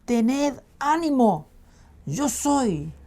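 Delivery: high, slow, stretched encouragement; reassuring call. Acted. Jesus calling to his frightened disciples, reassuring. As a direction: lift them up with a drawn-out call. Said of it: "Jesús les dice".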